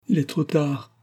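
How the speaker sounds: a touch of despair, falling away; heartbroken. A narrator's reading. Dying girl to her fiancé, mildly heartbroken. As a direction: let the words sink, lightly heartbroken. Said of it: "dit-elle avec une expression déchirante".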